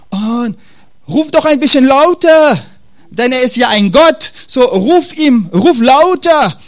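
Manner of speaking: mock encouragement with a sneer; mocking taunt. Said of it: "Ihr merkt, er verhöhnt die".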